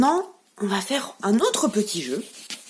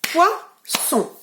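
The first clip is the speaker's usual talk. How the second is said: poisson split into its syllables, pois-son, higher; clapped syllables; 6 semitones higher than usual. Shown as a demonstration. Teacher claps syllables with the children, split into beats. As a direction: spell out poisson beat by beat for the class. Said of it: "On le fait ensemble".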